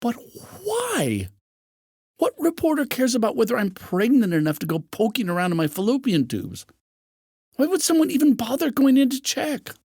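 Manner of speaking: high, strained protest; pleading, rising indignation; a high cry of outrage at the intrusion; distressed disbelief. Acted. Ellie's distressed outburst.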